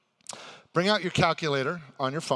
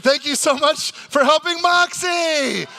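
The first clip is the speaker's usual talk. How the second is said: loud thanks; 12 semitones higher, 9 dB louder, slower than usual. Acted. Host thanks the guest onstage.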